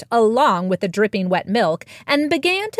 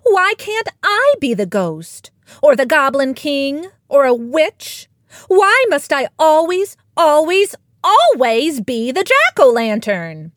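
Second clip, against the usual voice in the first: higher, louder cat's whiny protest; exasperated complaint; 6 semitones higher, 5 dB louder than usual. A narrator's reading. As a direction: protest it loudly, leaning hard on each repeat. Cat protests her usual part.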